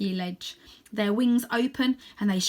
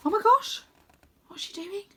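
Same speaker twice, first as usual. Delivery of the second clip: surprised reaction; 12 semitones higher than usual. Real, not acted. Reader reacts to story.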